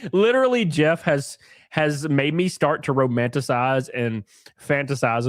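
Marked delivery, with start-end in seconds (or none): none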